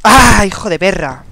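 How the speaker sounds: real gamer rage at getting killed; loud slowed curse as the enemy kills him; furious outburst. Real, not acted. Killed in the game.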